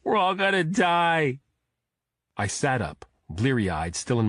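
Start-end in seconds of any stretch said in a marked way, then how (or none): 0.0-1.4 put-on higher, louder crying boy voice; blubbering. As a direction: wail it through tears. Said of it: "he blubbered".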